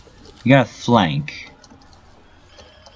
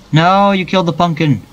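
high dismayed cry; 7 semitones higher than usual. Real, not acted. Dismay at teammate.

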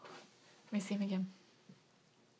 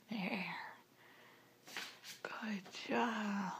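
whisper; 13 dB quieter, much slower than usual. Real, not acted. Mother whispering praise to toddler.

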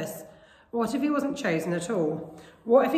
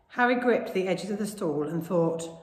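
usual speaking voice

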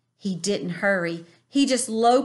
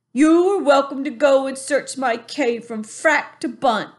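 higher, louder, politely firm giant; calm giant, higher pitch; 6 semitones higher, 7 dB louder than usual. A narrator's reading. BFG asking the bully to let go, politely firm.

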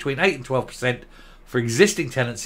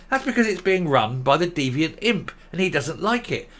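ordinary talk, nothing marked

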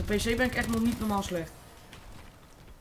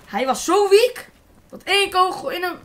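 excited exclaim; 8 semitones higher, 7 dB louder than usual. Real, not acted. Gamer amazed how fast the enemy died, excited. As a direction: blurt it out amazed.

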